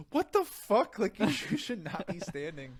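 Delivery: high, loud outraged outburst; shocked pulmonologist. Retold or imitated. Pulmonologist finding patient on her feet, shocked outburst. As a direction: imitate an appalled specialist, shrieking high. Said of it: "She's like".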